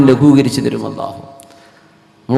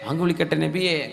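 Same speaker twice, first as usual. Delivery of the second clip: very soft, like a faint echo; hushed repeated request; 2 semitones higher, 12 dB quieter than usual. Acted. Bilal asking the Prophet about the call, hushed. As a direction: drop right down and ask gently.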